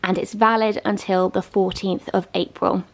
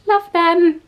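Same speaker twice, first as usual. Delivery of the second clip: gushing; 9 semitones higher, 5 dB louder than usual. Real, not acted. Gushing about the tiles.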